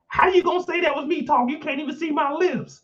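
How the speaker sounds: high-pitched mock protest; mock indignant Kendall. Kendall denying whispering in class, high mock indignation. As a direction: pitch it way up, imitating indignant denial. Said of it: "She talking about".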